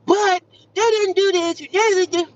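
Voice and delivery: high, whiny complaining customer imitation. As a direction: mimic a petty caller griping.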